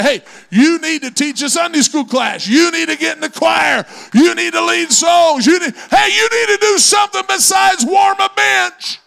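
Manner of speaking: high, loud shouting exhortation; preacher's shout. Retold or imitated. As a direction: bellow the call to serve, building. Pastor calling people to serve.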